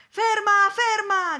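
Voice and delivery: high, full-voiced yell; shouting to stop someone. A narrator's reading. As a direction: holler after a runaway at the top of your voice. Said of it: "gridò il signore".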